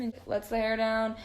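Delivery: ordinary talk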